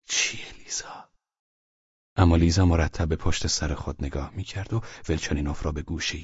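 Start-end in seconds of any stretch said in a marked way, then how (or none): 0.0-1.1 slow, soft, coaxing question; gentle and reassuring. Acted. Velchaninov coaxing scared Liza, soft and slow. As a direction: ask softly, to calm a frightened child. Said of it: "با لحن ملایم و دلگرم کننده".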